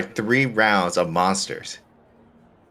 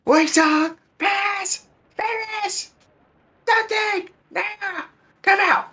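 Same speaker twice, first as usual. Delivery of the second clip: high calling out across the street; yelling names; much slower than usual. Retold or imitated. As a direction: shout names, high and far. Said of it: "just gonna hear me just yell everyone's name".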